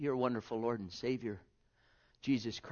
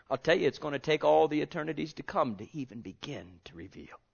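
ordinary delivery

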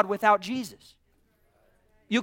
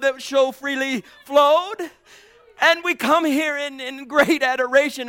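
higher and louder, pushing with praise; fervent preaching; 5 semitones higher, 6 dB louder than usual. Acted. Preacher calling the church to the Lord's Supper, fervent. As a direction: lift the room toward worship. Rising preaching toward communion.

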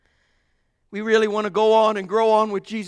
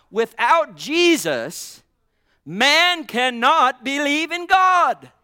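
slow emphatic preaching; 2 semitones higher, 6 dB louder, slower than usual. Acted. Preacher driving the point to the congregation, slow and forceful. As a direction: draw each phrase out, louder, with long pauses.

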